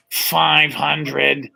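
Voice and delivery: slow robotic monotone of a voice box; electrolarynx voice. Retold or imitated. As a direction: imitate a man speaking through a throat device. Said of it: "it's one of those voice things".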